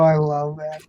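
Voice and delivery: ordinary delivery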